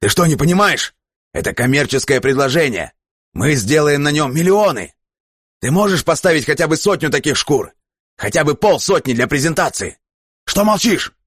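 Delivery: desperate to close the deal; frantic shouting. Acted. Captain shouts pleading after Kurt. (громко, с напором)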